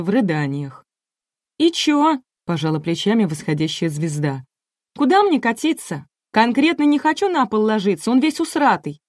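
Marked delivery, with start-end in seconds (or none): 1.6-2.2 высокий голосок недовольной звезды; возразить высоко, с вызовом; капризная певица. A narrator's reading. Капризная певица отвечает продюсеру. 5.0-9.0 высокий голос строптивой звезды; отказываться капризно, на высоких нотах; капризная певица. A narrator's reading. Певица отказывается падать на пол.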